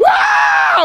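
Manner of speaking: very high, loud shriek; shrieking scream. Retold or imitated. Husband in the delivery room, piercing shriek. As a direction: scream like you're in agony. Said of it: "I'm going".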